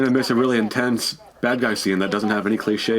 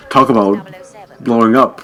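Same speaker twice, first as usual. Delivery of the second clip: loud one-liner with a dramatic pause; mock action-hero quip; 9 dB louder than usual. Player who should write for movies, loud mock quip. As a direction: punch out a hammed-up movie pun.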